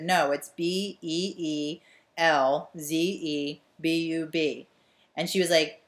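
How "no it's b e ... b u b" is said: slow letter-by-letter spelling; deliberate spelled-out correction. Retold or imitated. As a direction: spell each letter out slowly. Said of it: "and I said".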